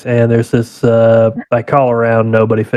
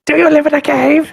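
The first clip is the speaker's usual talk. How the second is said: much higher squeaky quote; high cartoon voice. Friend quoting a cartoon, high mock voice. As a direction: pitch it way up like a cartoon.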